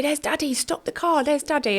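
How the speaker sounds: a hint of a small child pointing out the car window; mild imitation of a young son's eager call; little son calling out. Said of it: "he'd point and say".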